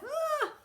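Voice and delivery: sudden high frustrated cry; showing the kids how mad feels; mock angry yell. Shown as a demonstration. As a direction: let out a short mad shout. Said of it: "I get so mad".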